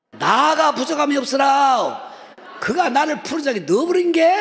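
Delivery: loud, high Jeolla dialect psalm. Shown as a demonstration. Pastor reciting Psalm 23 in Jeolla dialect, loud and high. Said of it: "이건 전라도 벌전이요".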